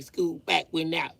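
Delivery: high-pitched mocking giggle; mocking kid laugh. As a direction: cackle at him like a smug kid.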